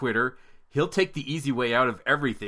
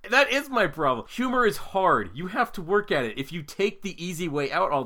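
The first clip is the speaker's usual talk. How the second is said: mock indignant; 7 semitones higher than usual. Comic indignant defence of his parenting.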